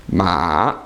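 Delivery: "Ma" sunk low, then rising at the end; dipping tone. Shown as a demonstration. Teacher saying the word for horse, falling then rising. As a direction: let "Ma" fall low and climb back. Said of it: "goes down and then back up again".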